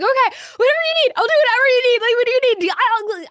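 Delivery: high, fast, loud gushing; overjoyed eagerness. Retold or imitated. Speaker answering editor who agreed to publish, high excited gushing. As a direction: tumble out eager thanks.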